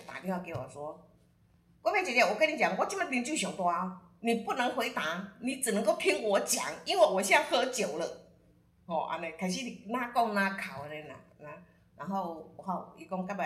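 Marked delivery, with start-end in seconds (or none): 1.8-8.3 loud, higher tipsy ranting, lightly acted; drunk caller. Drunk client on the phone to Guifei, loud tipsy rant. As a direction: play a drunk client demanding to be heard. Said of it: "他突然间喝了酒".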